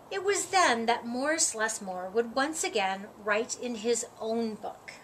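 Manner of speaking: usual speaking voice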